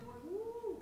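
a sudden high "woo!", dragged out slowly; excited whoop. Real, not acted. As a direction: whoop at the burn in the arms. Lifting book for fifteen minutes.

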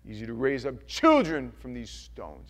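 stern prophet voice; lightly acted John the Baptist. John the Baptist warning the crowd, stern. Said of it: "and he goes".

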